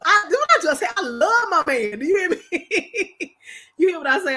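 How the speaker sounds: teary laughing declaration; laughing gush. Real, not acted. Wife telling viewers she loves her man, laughing. Gushing about loving husband.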